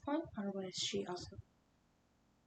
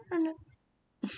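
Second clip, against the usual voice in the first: quick denial; 7 semitones higher, 10 dB louder, slower than usual. Acted. Sudden denial to suspect's look.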